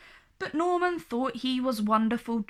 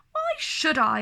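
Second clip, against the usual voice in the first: teasing; 6 semitones higher, 3 dB louder than usual. A narrator's reading. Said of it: "asked Prudence".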